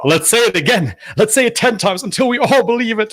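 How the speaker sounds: high, sing-song mock of the clip's speaker; mocking imitation. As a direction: parrot him back in a high mocking voice.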